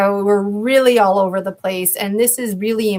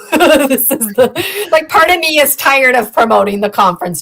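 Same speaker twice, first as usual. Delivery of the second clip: laughing; 5 semitones higher, 6 dB louder than usual. Real, not acted. Laughs while admitting tiredness.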